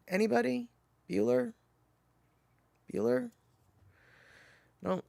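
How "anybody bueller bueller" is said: lightly voiced monotone teacher, softer and higher; level, quiet drone with pauses; droning roll call. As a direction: call out to an unresponsive class, deadpan. Deadpan roll-call movie quote.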